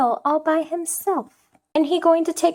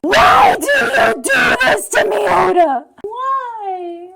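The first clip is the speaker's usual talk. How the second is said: loud wail, fading on the last word; hammed-up lament to the author; anguished mock plea; 5 dB louder than usual. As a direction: cry out as if betrayed, overdone. Pleads with the author.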